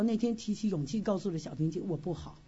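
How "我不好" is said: soft, low admission of pain; quiet confession. Retold or imitated. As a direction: say it softly, low and hesitant. Confessing to sister Xiaoping.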